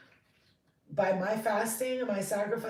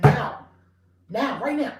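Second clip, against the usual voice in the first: urgent loud insistence; 4 semitones higher, 11 dB louder than usual. Real, not acted.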